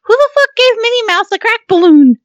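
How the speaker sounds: loud, very high, incredulous outburst; super high-pitched squeal. Acted. Host reacting to own recorded voice, super high-pitched squeal. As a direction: squeal it in mock outrage. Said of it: "I hear my voice and it's super high pitched".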